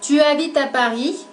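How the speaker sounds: "Tu habites à Paris." with the pitch lifting on "Paris"; rising question tune. Shown as a demonstration. Teacher showing how intonation alone makes a question. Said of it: "Elevamos la voz al final de la frase".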